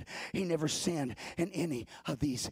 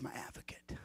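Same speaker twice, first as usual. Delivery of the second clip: soft whispered line; 3 semitones lower, 15 dB quieter than usual. Acted. Preacher whispering to the church, hushed. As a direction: breathe it out.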